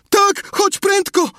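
very high, drawn-out urgent shout; yelling. A narrator's reading. Sid urging aunt upstairs, yelling in panic. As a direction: scream for someone to hurry. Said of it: "i wrzeszczał".

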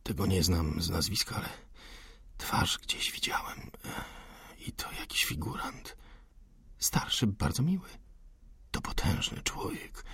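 hushed secretive whisper. Acted. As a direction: keep it under your breath. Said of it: "Szeptali jak najciszej". (cichy szept)

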